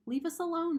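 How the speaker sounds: high, loud squeaking plea; frightened squeak. A narrator's reading. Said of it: "squeaked Dart".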